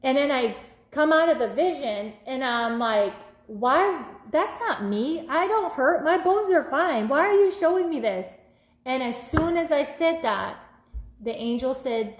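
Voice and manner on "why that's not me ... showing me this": higher-pitched, incredulous questioning; retold protest. Woman retells questioning the angel about her vision, protesting in a high voice. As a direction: imitate yourself protesting, voice lifted high.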